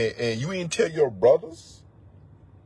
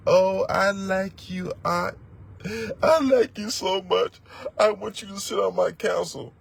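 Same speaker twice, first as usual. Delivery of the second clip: fawning invitation; 5 semitones higher than usual. Retold or imitated. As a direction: sweet-talk someone onto the council, mock fawning. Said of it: "you know what Israel do?".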